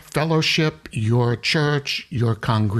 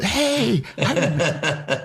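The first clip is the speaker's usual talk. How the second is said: playful mock call-out, much higher, breaking into a laugh; excited greeting; 6 semitones higher than usual. Imagined stranger spotting the Passover T-shirt, excited high greeting. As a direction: hail an old acquaintance in a high, sing-out voice. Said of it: "so somebody can come up".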